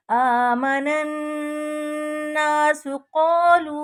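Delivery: नन्नासु with the नून held in the nose; nasal ghunna. Shown as a demonstration. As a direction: hum the doubled नून nasally. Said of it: "गुन्ना की, आवाज़ को थोड़ी देर नाक में". (नून पर गुन्ना, नाक में)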